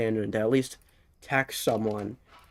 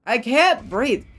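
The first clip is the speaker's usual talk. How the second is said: breathless cry; 8 semitones higher, 6 dB louder, much slower than usual. Real, not acted. Laughing, gasping for breath.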